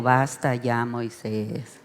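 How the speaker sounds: quiet, gentle "Basta ya, Moisés"; soft, not angry. Shown as a demonstration. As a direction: say "Basta ya" softly, with no anger. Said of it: "Dios no le dijo". ("Basta ya" dicho suave, sin enojo)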